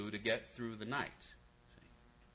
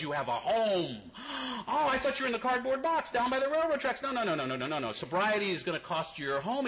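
voicing God; 7 semitones higher than usual. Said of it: "He goes".

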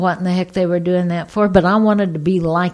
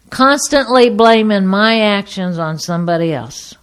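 ordinary talk

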